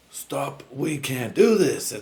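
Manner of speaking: lightly acted plea to stop, drawn out; half-earnest dramatic plea to halt; mock plea. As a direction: plead slowly, pitch jumping up on the end. Acting last two fighters' plea.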